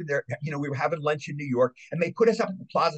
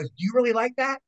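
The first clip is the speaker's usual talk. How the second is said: pitched up in disbelief; surprised question; 10 semitones higher than usual. Retold or imitated. John asking the guest, higher and surprised. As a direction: ask as if you can't believe it. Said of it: "he seemed surprised that I liked it".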